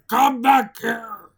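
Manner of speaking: loud, slow drawn-out yell; angry yell. Acted. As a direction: bellow after the runaway. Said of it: "Mister Krabs yelled".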